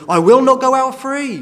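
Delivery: high and loud vow; fervent declaration. Acted. Preacher voicing the servant who refuses freedom, high and loud. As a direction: declare it with full conviction.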